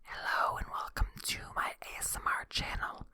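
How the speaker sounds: breathy close-mic whisper; ASMR whisper. Retold or imitated. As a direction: whisper like an ASMR channel intro. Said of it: "they're not the".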